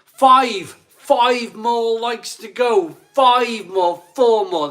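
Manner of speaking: loud, high, stretched-out calls; rally the crowd for the last few; hollered, hyped-up countdown; excited countdown shout. Real, not acted. Counting down to like target.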